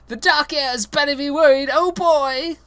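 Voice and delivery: high loud yell. Acted. Reader voices Harry yelling his name, high and loud. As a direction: shout it proudly. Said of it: "he began yelling".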